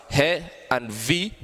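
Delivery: heavy split into HE and V, drawn out; syllable by syllable. Shown as a demonstration. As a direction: say "he" and "V" as separate slow syllables. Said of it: "two, two syllable word".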